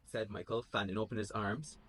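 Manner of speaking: plain reading voice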